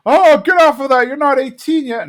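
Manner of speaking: loud, very high-pitched mock panic; alarmed scolding. Hank runs out to Bobby on the bed, mock scolding. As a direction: imitate a flustered dad's outburst.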